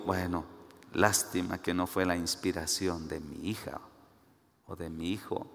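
low, subdued aside to himself; quiet regret. Acted. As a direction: murmur it with mild disappointment. Father's private regret at a message.

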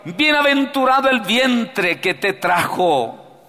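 woman crying out loud and high. Acted. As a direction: call out praise from the crowd. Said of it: "levantó la voz y le dijo".

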